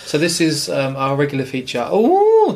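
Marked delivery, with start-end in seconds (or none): 1.9-2.5 sudden high loud oh; surprised. Real, not acted. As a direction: blurt it out in shock. Sudden outburst mid-sentence.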